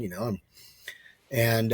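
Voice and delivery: ordinary talk, nothing marked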